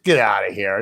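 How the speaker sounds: low, disbelieving brush-off; dismissive impersonation. Retold or imitated. Man to panicking drivers, dismissive.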